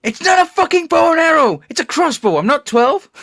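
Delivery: loud, high indignant quote; mock angry rant. Quoting the movie, an indignant mock outburst. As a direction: snap it like an offended Harley.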